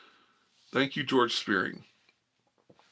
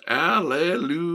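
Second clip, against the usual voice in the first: mock preacher hallelujah; 7 semitones higher than usual. Playing preacher to the chat, a high hallelujah. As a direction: sing out the praise, hammed up.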